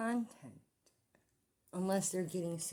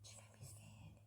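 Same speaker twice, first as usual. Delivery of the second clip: hushed, scared whisper; 19 dB quieter than usual. Real, not acted. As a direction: whisper so nobody hears. Whispers while cleaners are outside.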